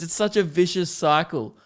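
ordinary talk, nothing marked

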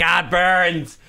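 high-pitched comic yelp of pain; mock burning agony. Cook retelling his over-garlicked recipe, hammed-up burning pain. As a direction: play up a garlic burn, high and overdone.

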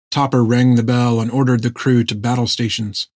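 usual speaking voice

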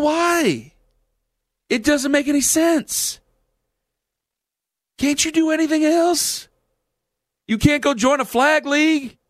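high, slow, hammed-up announcer twang; Jim Ross voice. Host mocks his football-playing buddies, high slow Jim Ross voice. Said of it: "do Jim Ross impressions".

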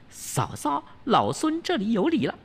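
high, sly monkey voice greeting sister-in-law; fake-polite bow from the Monkey King; high cheeky Wukong voice.

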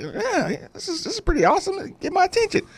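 high, soft retold musing; light, hushed voice for an imitated reaction; mildly impressed listener. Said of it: "He's like".

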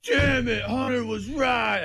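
mock grudging shout. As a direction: shout it like a sore loser. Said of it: "I can hear it right now".

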